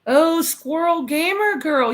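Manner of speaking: light up at the name; slow happy call-out; excited greeting. Real, not acted. Greeting a chatter warmly.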